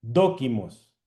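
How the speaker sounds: normal talking voice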